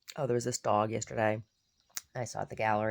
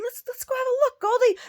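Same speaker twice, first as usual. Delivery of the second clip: much higher, louder coaxing; dog baby talk; 9 dB louder than usual. Retold or imitated. Coaxing Goldie to the mirror, high baby talk. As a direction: coax the little dog.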